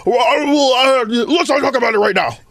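flustered Bernie, dodging the question; Bernie Sanders impression. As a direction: stammer and deflect like Bernie. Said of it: "you do a great Bernie".

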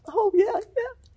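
very high, squeaky mock-crying voice; tearful agreement. Retells her tearful reply to hug.

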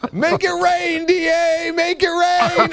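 high, drawn-out playful holler; mock club hype. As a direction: whoop it up like a club hype man. Playful strip-club cheer, coins flying.

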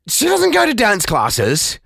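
normal talking voice

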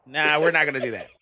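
normal talking voice

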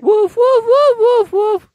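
very high, loud dog barks; squeaky little dog barking; high yappy woofs. Shown as a demonstration. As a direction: yap like a tiny dog, way up high. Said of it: "almost hearing a chihuahua".

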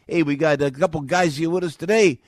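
loud, high Jersey Italian guy voice; brash lounge-host Jersey Italian swagger; Vinnie Ciccolini voice. Retold or imitated. Host greeting his studio guests in a loud Jersey Italian voice. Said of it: "I was talking like this".

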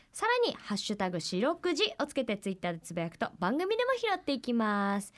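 steady, clear info read; formal radio notice; radio announcement read. Acted. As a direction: read the address out for listeners. Reads out how to send mail.

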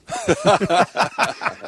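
a loud high laugh bursting out; high burst of laughter. Real, not acted. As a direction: laugh hard and high, delighted. Laughs at son's remark.

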